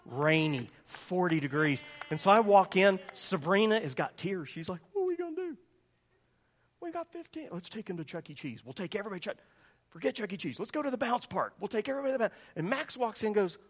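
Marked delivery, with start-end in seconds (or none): 4.9-12.3 voicing worried wife. Wife voiced in panic.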